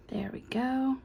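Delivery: soft unvoiced whisper. Real, not acted. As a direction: breathe it out as you finish. Quietly fitting a small piece.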